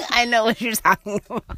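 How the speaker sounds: laughing through the words, quick; amused recognition, laughing. Real, not acted. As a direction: say it while giggling. Laughing while recognizing the place.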